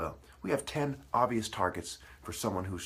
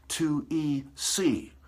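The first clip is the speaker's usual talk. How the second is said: spelled out slowly; 7 semitones higher, 4 dB louder, much slower than usual. Shown as a demonstration.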